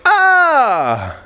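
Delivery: high "ah" sliding down; high cry that drops. Shown as a demonstration. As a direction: start high, let it fall. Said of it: "my voice goes to drop".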